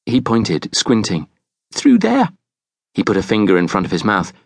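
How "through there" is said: put-on high little-boy voice; frightened boy. A narrator's reading. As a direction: answer as a scared child, pitched high. Frightened boy answers the stranger.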